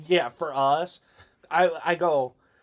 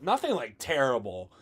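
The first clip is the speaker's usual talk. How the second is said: ordinary talk, nothing marked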